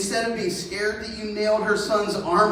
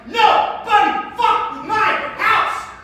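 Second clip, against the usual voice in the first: put-on much higher, loud drunk roar; drunken shout; 10 semitones higher, 4 dB louder than usual. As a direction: bellow a warning to the world. Drunk Beowulf nails arm to door.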